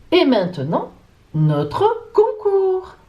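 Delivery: drawn-out, high game-show reveal; stretched, lifted drumroll announcement; contest announcer. Acted. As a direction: announce it with a long sung-out flourish. Host announces the contest segment.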